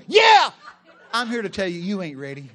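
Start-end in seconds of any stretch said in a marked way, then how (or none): 0.1-0.5 loud, high yelled yeah; shouted reply. Retold or imitated. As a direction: yell it back, all nerve. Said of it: "You go".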